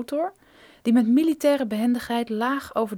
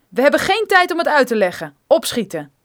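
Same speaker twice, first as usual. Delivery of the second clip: urgent command; 2 semitones higher, 9 dB louder than usual. Acted. Gunman ordering Brophy to hurry, loud and urgent. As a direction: hurry him at gunpoint.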